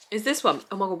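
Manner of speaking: normal talking voice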